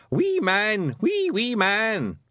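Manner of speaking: put-on delighted toddler. A narrator's reading. Wentworth chuckling at Rob, delighted toddler voice.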